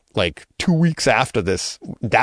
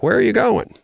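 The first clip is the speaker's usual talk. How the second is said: surprised mom; 13 semitones higher than usual. Retold or imitated. Mom surprised seeing him dressed.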